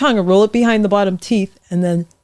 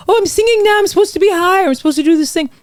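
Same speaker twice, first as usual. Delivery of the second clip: much higher, pushed mock singer voice; mock eager singer; 10 semitones higher, faster than usual. Coach mocking students who think singing must be high. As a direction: parody someone straining to sound high. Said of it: "people get ideas like".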